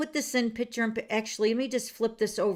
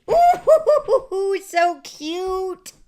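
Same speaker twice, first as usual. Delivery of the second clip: high, loud squeal with a giggle; giggling ooh so cute; delighted squeal; 8 semitones higher, 9 dB louder, much slower than usual. Real, not acted. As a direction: squeal over the cute card. Showing the hippo card.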